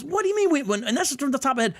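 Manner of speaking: high, fast exasperated disbelief; incredulous outburst. Real, not acted. Reviewer listing gospel facts in rapid, high disbelief. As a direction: throw up your hands, voice leaping high. Reacting to quoted overstatement.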